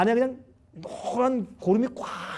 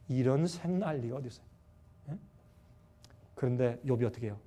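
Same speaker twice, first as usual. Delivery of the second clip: normal talking voice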